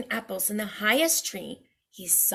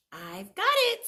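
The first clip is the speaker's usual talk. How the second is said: high, eager little pig; chirpy pig getting an idea; 9 semitones higher than usual. Acted. Second pig buying sticks, high eager pig.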